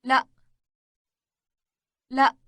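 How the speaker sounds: normal talking voice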